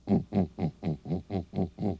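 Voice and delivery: steady string of short hoots; gorilla grunts. Shown as a demonstration. Teacher presenting a gorilla, rhythmic ape grunts.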